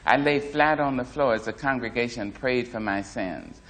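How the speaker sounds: ordinary delivery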